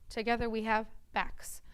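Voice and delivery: usual speaking voice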